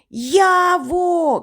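high, drawn-out call; поклик уголос. A narrator's reading. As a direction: call out aloud for a missing friend. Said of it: "кричу вголос". (високий крик уголос)

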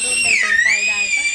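high, loud exclaiming shout; sudden loud outburst. Real, not acted. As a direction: burst out loud and high about the tea. Sudden shout about tea. (టీ గురించి గట్టిగా అరిచినట్టు)

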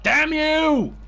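high, loud curse; enraged shout at the game; furious yell. Real, not acted. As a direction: hurl it at the mystery box. Bad weapon from the box.

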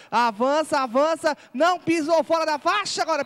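loud, rising run call; excited play-by-play. Real, not acted. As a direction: push the call up as he runs.

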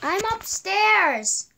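loud, drawn-out call upstairs; yelling across the room. Real, not acted. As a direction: shout it to someone in another room. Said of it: "Sorry about that, I was yelling".

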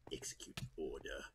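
low, slow raspy whispered command; Palpatine whisper. Acted. Gamer playing Palpatine giving the order, whispered low. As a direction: hiss the order like a dark lord. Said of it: "You sound like Palpy".

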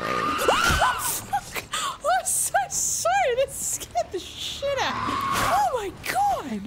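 real high-pitched fright; very high, startled outcry; startled shriek. Real, not acted. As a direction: shriek it, shocked by a sudden scare. Startled by a jump scare.

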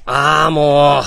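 loud exasperated groan. Real, not acted. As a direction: groan it out, fed up with the gear. Overloaded with gear, stream failing.

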